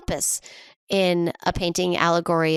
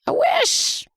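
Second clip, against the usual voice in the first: a much higher squeaky "I wish". Pretend. Echoing her own wish to her co-host, squeaky high.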